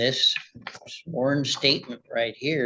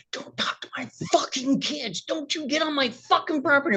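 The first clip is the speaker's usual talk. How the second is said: mock furious neighbor yell, higher pitched; retold screaming; 12 semitones higher than usual. Neighbor screaming at him over the kids, imitated higher and angrier. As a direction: imitate the raging neighbor's rant. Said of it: "spitting, screaming".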